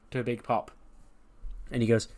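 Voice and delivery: ordinary talk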